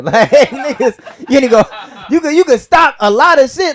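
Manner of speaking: laughing exasperation. Real, not acted.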